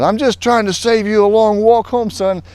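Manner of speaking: high, loud gruff instructor teasing the jumper; instructor's voice. Retold or imitated. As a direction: call it out like a drill instructor. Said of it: "he says".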